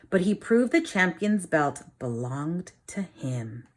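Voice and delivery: lively storybook reading for a class; reading to children. A narrator's reading. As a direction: read it like a teacher reading a picture book to the class. Reads picture book aloud.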